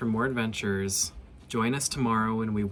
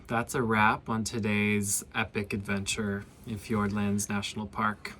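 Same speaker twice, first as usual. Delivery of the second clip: ordinary talk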